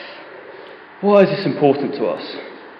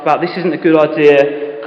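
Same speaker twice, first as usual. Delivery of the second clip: normal talking voice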